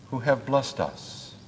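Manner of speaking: usual speaking voice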